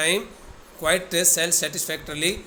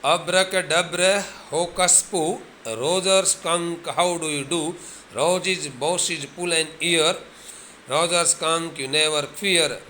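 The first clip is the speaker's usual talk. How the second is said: normal talking voice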